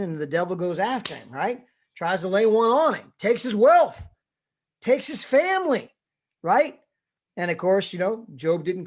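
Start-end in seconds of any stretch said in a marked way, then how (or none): 1.9-6.8 louder and higher, building the attack; climbing sermon intensity; rising preacher fervor. Real, not acted. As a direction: drive it up as the devil strikes.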